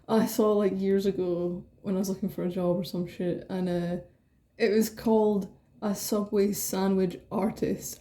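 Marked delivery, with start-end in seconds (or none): none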